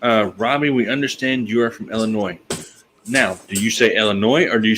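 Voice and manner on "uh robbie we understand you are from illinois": usual speaking voice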